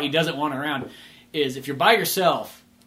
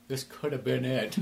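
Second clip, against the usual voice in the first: wistful murmur; 3 semitones lower, 10 dB quieter than usual. Retold or imitated. Wistful character thought.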